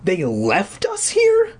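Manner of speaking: put-on high, slow, frightened boy's voice; scared little brother. A narrator's reading. Eddie, left behind in the tower, asking high and scared. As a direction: let the fear stretch the words.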